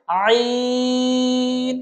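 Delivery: আইন from mid-throat, long level vowel; mid-throat articulation. Shown as a demonstration. Teacher models the throat letter, আইন drawn out. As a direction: chant আইন from the middle of the throat. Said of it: "কণ্ঠনালীর মধ্যখান থেকে".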